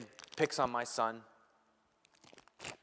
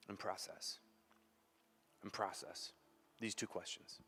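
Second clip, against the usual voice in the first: much softer, hushed; soft, reflective; 7 semitones lower, 18 dB quieter than usual. Acted. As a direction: let it settle quietly.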